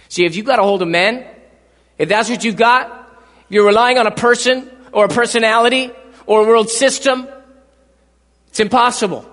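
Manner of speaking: loud, slowing preacher's climax; booming sermon close; full-force preaching. Acted. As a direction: drive it home to the congregation.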